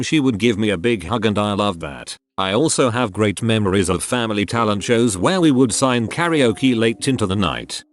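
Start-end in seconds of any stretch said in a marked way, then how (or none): none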